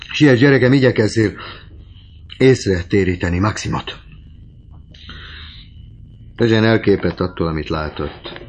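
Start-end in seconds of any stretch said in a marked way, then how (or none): 0.1-4.0 high, loud hurrying plea; agitated exhortation, easing at the end; urgent urging. A narrator's reading. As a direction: send her off urgently, voice raised. Goriot urges daughter to hurry.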